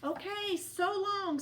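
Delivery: high, bouncy goodbye; cheerful tumbleweed. A narrator's reading. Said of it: "said Rosie cheerfully".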